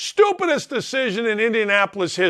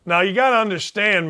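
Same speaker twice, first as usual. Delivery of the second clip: ordinary talk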